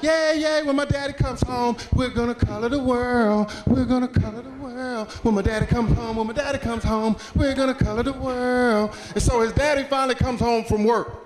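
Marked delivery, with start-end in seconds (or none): 0.0-9.0 put-on high, singsong childlike glee; excited little kid. Son cheering for Daddy coming home, excited. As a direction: chant it eagerly, like a kid who can't wait. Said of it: "he's all excited".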